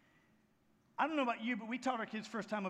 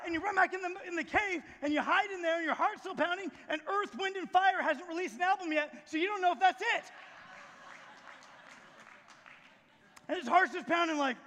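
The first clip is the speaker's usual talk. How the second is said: high, raised storytelling voice; preaching at full force; 6 semitones higher, 6 dB louder than usual. Acted. Preacher retelling the tornado on the mountain, full-force preaching. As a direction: build the storm in a raised, urgent voice.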